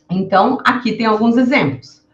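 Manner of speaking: normal talking voice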